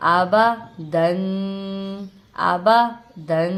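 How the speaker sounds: slow chanted model reading; whole-word reading. Shown as a demonstration. Teacher joins the syllables into the whole word, slow drawn-out recitation. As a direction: draw out the closing nasal syllable. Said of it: "پورے لفظ کو ایک ساتھ کر کے پڑھا".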